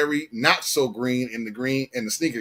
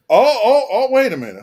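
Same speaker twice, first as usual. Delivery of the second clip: surprised; 10 semitones higher, 9 dB louder than usual. Real, not acted. Sudden realization mid-conversation.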